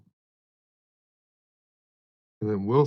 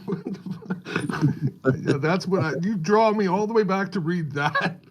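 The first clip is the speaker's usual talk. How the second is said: laughing; 8 semitones higher, slower than usual. Real, not acted. Laughing at old post.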